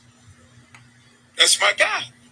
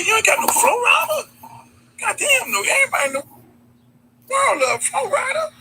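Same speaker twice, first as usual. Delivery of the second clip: worked-up rant. Retold or imitated. Retelling his complaint, worked up.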